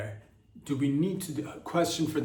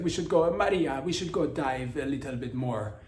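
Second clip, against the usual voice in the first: Sergio. Acted. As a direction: ham up an Italian man's accent. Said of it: "Sergio's like".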